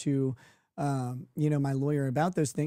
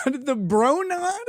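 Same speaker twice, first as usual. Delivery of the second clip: incredulous; 10 semitones higher, 4 dB louder, much slower than usual. Real, not acted. Incredulous at the bro nod.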